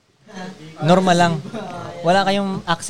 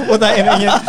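ordinary talk

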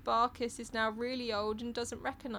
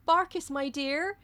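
tender; 6 semitones higher, 4 dB louder, slower than usual. A narrator's reading. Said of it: "Lady Peggotty goes".

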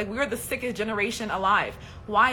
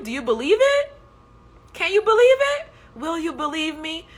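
Jesus pleading; 7 semitones higher, 6 dB louder than usual. Acted. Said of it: "He was like".

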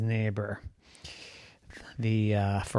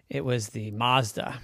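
ordinary delivery

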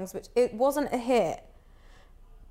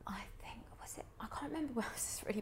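groggy hungover mumble; 18 dB quieter, faster than usual. Real, not acted. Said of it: "Sorry, I am hungover today".

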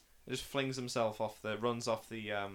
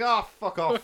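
high, loud mock dismissal; Gandalf, exasperated; 6 semitones higher, 9 dB louder than usual. Podcaster retells the wizard silencing the king, mock exasperation. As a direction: snap it as an imitated fed-up commander. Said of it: "And then Gandalf just comes and".

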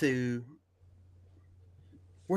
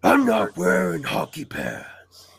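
slow, forceful hero rasp; Batman; 3 semitones higher, 4 dB louder, much slower than usual. Acted. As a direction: drag it out in a put-on hero voice.

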